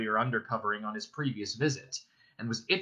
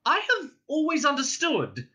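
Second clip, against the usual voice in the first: much higher, timid hobbit squeak; frightened squeak; 3 dB louder than usual. Acted. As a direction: squeak it out nervously. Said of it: "in a frightened squeak".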